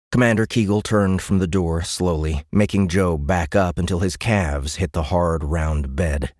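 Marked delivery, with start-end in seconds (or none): none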